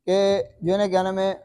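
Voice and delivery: ordinary delivery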